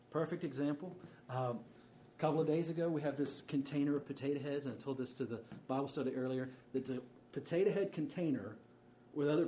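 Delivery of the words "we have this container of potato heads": ordinary talk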